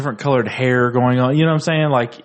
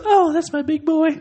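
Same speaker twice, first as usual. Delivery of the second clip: very high, cooing mother voice; hammed-up proud mommy impression; doting cartoon mom. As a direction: coo it in a squeaky mock-mom falsetto. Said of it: "And she goes".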